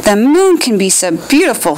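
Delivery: ordinary delivery, nothing marked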